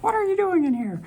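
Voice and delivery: high, quick mock challenge; put-on high-pitched questioning voice; someone catching an intruder. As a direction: squeak it out like someone who caught you. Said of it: "Hopefully no one's like".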